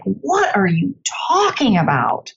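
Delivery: slow, drawn-out incredulous question; mock disbelief. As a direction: stretch it out as if utterly baffled. Retold baffled reaction of listeners.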